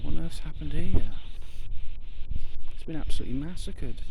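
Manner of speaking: loud, high shocked exclamation. Real, not acted. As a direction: react in disbelief to the sight. Finds a dead bird.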